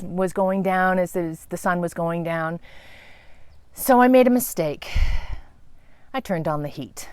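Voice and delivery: usual speaking voice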